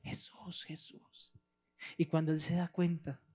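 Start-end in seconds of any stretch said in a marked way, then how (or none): 0.0-1.3 whispered Jesús drifting away; fading distant call. Acted. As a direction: let the name die off in a whisper. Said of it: "alejarse una vez más".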